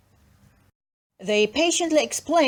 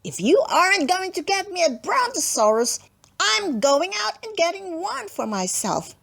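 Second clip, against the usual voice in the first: put-on defiant child; 7 semitones higher than usual. Lulu threatens parents.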